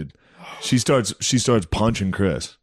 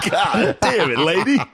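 laughing exasperation; 10 semitones higher than usual. Retold or imitated. Reacting to insult, laughing.

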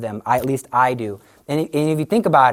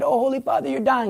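alarmed onlookers; 9 semitones higher than usual. Retold or imitated. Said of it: "they said".

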